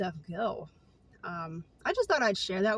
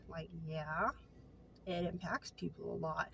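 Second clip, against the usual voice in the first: much softer, lower aside; quiet aside; 4 semitones lower, 12 dB quieter than usual. Real, not acted. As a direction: drop it to a low murmur.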